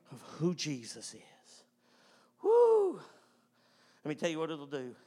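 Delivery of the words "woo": high loud woo; preacher's whoop. Real, not acted. Preacher to congregation, high excited whoop. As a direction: let out a joyful whoop.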